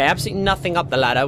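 ordinary delivery

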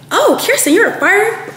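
high, amazed coworker voice; mock surprise. Coworkers learning she fought, high mock amazement. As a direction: play the shocked coworkers.

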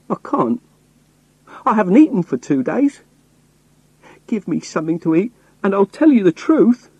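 high, pleading beggar's whine; begging voice. A narrator's reading. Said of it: "I put on my best begging voice".

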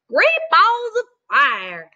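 a goose finding the nest; exclaiming goose. Acted. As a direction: stretch out the exclamation. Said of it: "she cried".

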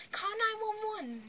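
high, drawn-out call for help; retold onlooker's cry; imitating an alarmed bystander.

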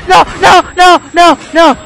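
rapid frightened pleading, loud; panicked no. Real, not acted. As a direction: beg it away in real panic. Panicking at game threat.

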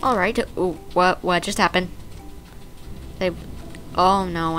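usual speaking voice

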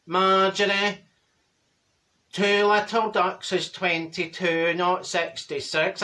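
slow, droning correction; lugubrious, stretched-out words; droopy Dorothy. Acted. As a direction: correct her in a dragging monotone.

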